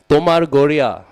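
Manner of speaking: a loud rebuke to Sarup Damodar; upset outburst. Retold or imitated. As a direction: call it out sharply, displeased. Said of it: "becomes very upset".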